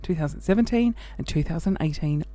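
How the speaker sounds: normal talking voice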